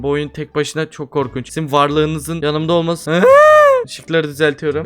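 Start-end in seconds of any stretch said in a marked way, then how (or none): 3.3-3.8 very high, loud scream; sudden piercing yelp of fright; frightened shriek. Real, not acted. Player scared while playing horror game alone, shrieking.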